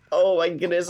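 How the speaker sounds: ordinary talk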